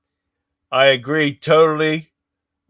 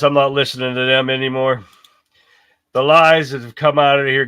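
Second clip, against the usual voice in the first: ordinary talk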